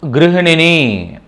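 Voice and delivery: గృహిణిని said slowly, each syllable drawn out; slow, careful teacher demo of గృహిణిని; careful Hindi pronunciation. Shown as a demonstration. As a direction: spell out గృహిణిని slowly for the learner. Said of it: "హిందీలో చెప్పాలనుకున్నప్పుడు గృహిణి అంటారన్నమాట".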